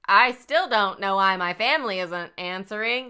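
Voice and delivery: stiff robotic fake worry. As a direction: deliver it like a robot. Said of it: "I'm a robot".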